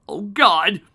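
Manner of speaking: loud high dread; horrified groan. Real, not acted. Player dreading the story, loud high cry. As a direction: cry out as if you know what's coming.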